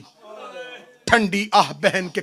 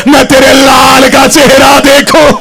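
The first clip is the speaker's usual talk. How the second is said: weeping cry; 3 semitones higher, 12 dB louder than usual. Acted. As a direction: plead through tears at full voice. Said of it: "रो के कहती".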